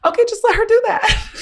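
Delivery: high, laughing through the words; laughing quote. Retold or imitated. Therapist laughing at her tantrum, amused and high. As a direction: let it out on a laugh.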